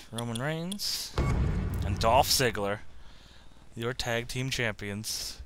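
slow ring announcer call. Pretend. Announcing the game's champions.

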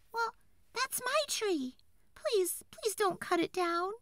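tiny, very high fairy voice; pleading tree fairy. A narrator's reading. Tree fairy begs woodcutter for her tree, high pleading. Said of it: "cried the tree fairy".

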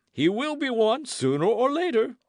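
high-pitched, emphatic proclamation; exclaiming. A narrator's reading. Porthos predicts Aramis's future as abbé, exclaiming.

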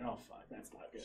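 hushed under-breath curse; whispered to himself on realizing he drove; whispered panic. Retold or imitated. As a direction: mutter it to yourself in dread. Panicked inner thought, whispered.

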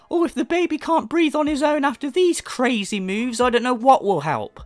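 mocking mother. Mocking the influencer mum with a high squeaky whine. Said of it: "mocking the mother".

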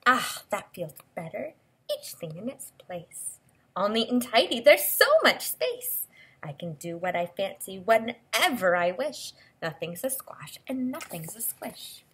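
lilting storybook read-aloud; expressive picture-book voice; reading to children. Acted. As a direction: read the book's lines to a little listener.

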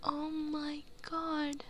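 slow, soft stunned gasp of disbelief. Real, not acted. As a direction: let the shock sink in slowly. Shocked by bad ending.

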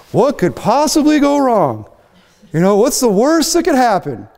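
high, drawn-out sarcastic innocence; mock naive. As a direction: ask it as if blissfully blind to danger. Mock naive question about obvious danger.

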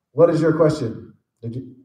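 ordinary talk

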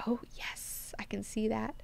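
hushed, whispered reaction; very soft, private murmur of agreement; whispered aside. Retold or imitated. Aside giving own sly reaction.

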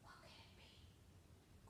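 hushed suspense whisper; spooky storytime whisper. Acted.

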